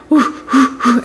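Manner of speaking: huffed 'whoo' breaths; imitated winded puffing. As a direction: puff it out as if winded. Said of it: "I'm like".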